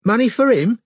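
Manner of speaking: high-pitched, slightly softened suspicion. A narrator's reading. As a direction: ease off the hostility as money comes up. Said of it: "with a degree less animosity".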